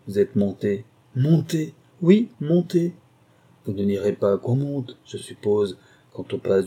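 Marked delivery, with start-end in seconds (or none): 1.2-2.9 high, emphatic question and answer; indignant exchange. Acted. Husband and wife quarrelling over his marriage, indignant. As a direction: throw the word back, then insist.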